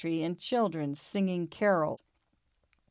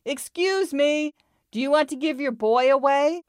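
polite shout; 9 semitones higher, 6 dB louder than usual. A narrator's reading. Puppy shouting across the street to a Scotty, politely hollering. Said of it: "shouted at the top of his lungs, but polite as could be".

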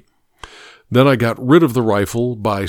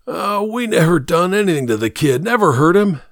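high-pitched, defensive protest; protesting denial; 7 semitones higher than usual. Acted. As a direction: plead innocence in a raised voice. Accused kidnapper protests his innocence.

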